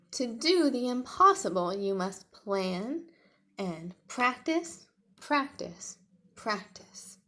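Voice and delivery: loud, then slow, fading repeats of practice; wise advice. Acted. Friend advising Tiny to plan and practice. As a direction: give advice firmly, slowing down.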